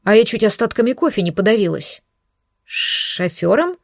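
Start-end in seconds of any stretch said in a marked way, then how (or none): 2.6-3.8 slow, rising disbelieving echo; surprised question. A narrator's reading. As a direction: repeat it back in surprise. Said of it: "переспросила".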